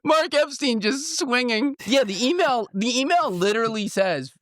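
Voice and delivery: very high, squealing with amusement; giggly disbelief. Real, not acted. Host reporting the outrageous email, cracking up.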